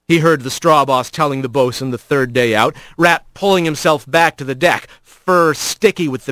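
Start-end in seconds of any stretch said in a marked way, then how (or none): none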